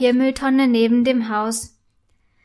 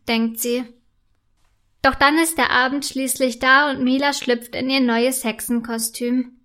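usual speaking voice

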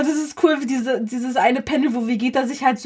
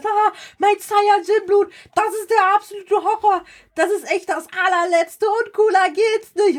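high, hammed-up theatrical lament; over-the-top drama; 8 semitones higher than usual. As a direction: wail it like a tragic hero. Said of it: "Also total übertrieben".

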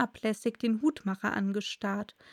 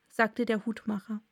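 ordinary delivery, nothing marked

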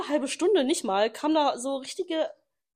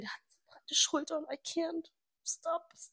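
whispered, higher, overwhelmed; swooning whisper; 6 semitones higher, 10 dB quieter than usual. Real, not acted. Gushing to her friend about his shoulders, whispering. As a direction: breathe it out, overcome.

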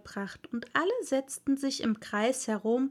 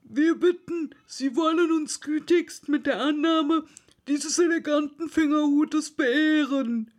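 loud, higher ceremonial address; solemn Dodo; 5 semitones higher, 5 dB louder than usual. A narrator's reading. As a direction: present it like a pompous official speech. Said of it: "ihr den Fingerhut feierlich überreichte".

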